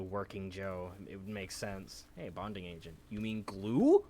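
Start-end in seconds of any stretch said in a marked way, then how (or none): 3.1-4.0 louder teasing. Acted. Mock dumb question.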